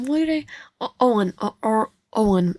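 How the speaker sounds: ordinary talk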